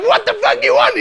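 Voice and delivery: high, loud frightened demand; loud panicked demand; mock shout. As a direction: yell it at the gunman. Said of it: "So I'm like".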